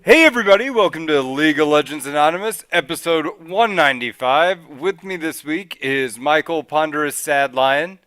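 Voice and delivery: loud, high show opening; projected announcer-style welcome; podcast host intro. Acted. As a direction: launch the show big and loud. Opens the podcast episode.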